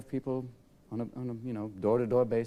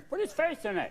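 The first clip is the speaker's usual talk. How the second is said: voiced dog; 12 semitones higher than usual.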